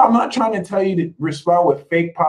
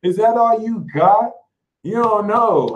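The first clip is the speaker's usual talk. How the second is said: higher, loud, rising taunt; taunting sass; 6 semitones higher, 3 dB louder than usual. Pretend. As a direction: dare them to try harder. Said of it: "And be like".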